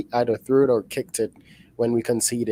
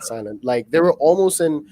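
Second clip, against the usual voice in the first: ordinary talk, nothing marked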